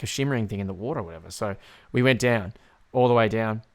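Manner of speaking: normal talking voice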